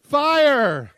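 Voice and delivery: loud, high shouted command; proud shout. Acted. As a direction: shout it as if you solved it. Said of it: "And he goes".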